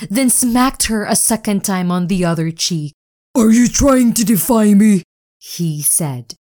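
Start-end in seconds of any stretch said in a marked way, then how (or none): 3.3-5.0 loud, menacing demand; furious owner. Acted. Owner grabbing Spirit, raging at her. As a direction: threaten her for defying you.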